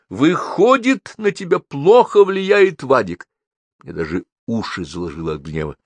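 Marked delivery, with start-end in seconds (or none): 0.1-3.3 high, slow, drawn-out conclusion; mother's emphatic, deliberate judgement; pronouncing a verdict. A narrator's reading. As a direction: spell it out in a high pitch. Mother declares a silly verdict.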